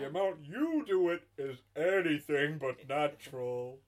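indignant Santa, high and loud then settling; hammed-up American Father Christmas scolding. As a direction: lecture sternly in a silly Santa voice. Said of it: "keep up this accent".

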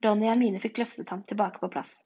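ordinary delivery, nothing marked

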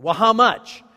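loud, high-pitched acted demand; pressing challenge. Pastor quizzing member who claims to have prayed, pressing challenge.

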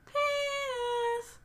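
high, drawn-out mock yell, held back in volume; little child yelling. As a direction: imitate a tiny voice yelling from afar. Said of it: "just yelling".

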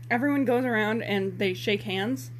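ordinary delivery

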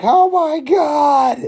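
high, slow, stretched exclamation; mock horror. Everyone watching him shoot himself, mock horror. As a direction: draw out each word in high horror.

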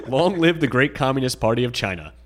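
mock pompous, laughing through it; laughing while reading. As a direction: read it grandly, breaking into laughter.

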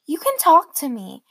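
high coaxing parent voice. Retold or imitated. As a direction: coax it out of your child gently. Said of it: "that voice all parents use".

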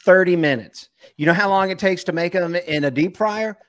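ordinary talk, nothing marked

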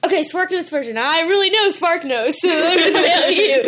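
laughing. Real, not acted. Joking about knowing Spark Notes.

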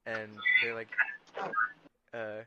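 normal talking voice

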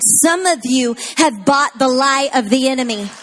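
loud, high, rising sermon call; full-force preaching. Acted. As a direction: rouse the congregation, building to a shout.

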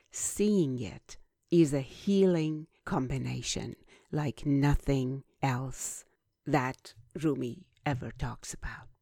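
usual speaking voice

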